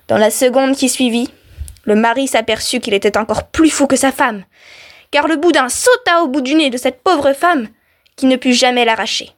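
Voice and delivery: usual speaking voice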